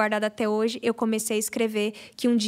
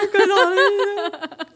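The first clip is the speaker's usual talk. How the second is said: higher and louder, laughing through near tears, slow; moved laughing; 9 semitones higher, 7 dB louder, much slower than usual. Real, not acted. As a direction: let the joy spill out in a teary laugh. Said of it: "Nossa, eu fiquei assim". (riso emocionado, quase chorando, alto e devagar)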